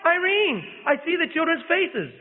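very high, slow, drawn-out rapture; awed, rapturous cry; joyful rapture. A narrator's reading. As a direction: call out as if seeing them in heaven. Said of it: "in a voice of joyful rapture".

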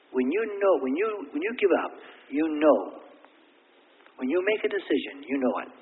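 high, loud preaching peak; fervent preaching. Real, not acted. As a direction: drive it home to the church. Preaching climax on surrender.

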